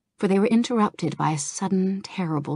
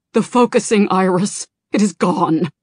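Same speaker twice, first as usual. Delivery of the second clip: loud, strained alarm; panicked report; 2 semitones higher, 7 dB louder than usual. Acted. Naragos telling Kalik the iris is gone, panicked shout. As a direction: blurt out the disaster.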